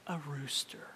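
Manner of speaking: hushed whispered reveal; breathy storyteller whisper; dramatic whisper. Acted. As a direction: whisper it like a secret.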